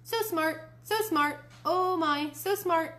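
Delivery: high-pitched mynah echo; bird voice. A narrator's reading. Mynah bird repeating Grandma's words, bird voice. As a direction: parrot it high, over and over. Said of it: "Uncle Feather said".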